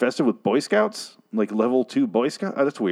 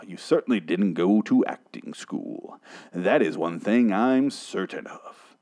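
Morgan Freeman; 5 semitones lower, 3 dB quieter than usual. Acted. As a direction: intone it deep and poetic, unhurried. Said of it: "I'm gonna do a Morgan Freeman voice for him".

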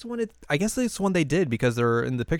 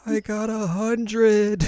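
high, slow, hammed-up triumph; triumphant reveal; 10 semitones higher, 4 dB louder, much slower than usual. Yui revealing her exam score, high, slow and hammed-up. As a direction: unveil it like a shaky victory. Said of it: "holds it up, like, trembling".

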